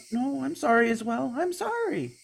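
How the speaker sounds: put-on high, nervous girl voice, stumbling; flustered young girl. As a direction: panicky girl over-explaining herself. Girl character apologizes.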